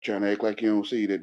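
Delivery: ordinary talk, nothing marked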